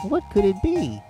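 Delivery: ordinary delivery, nothing marked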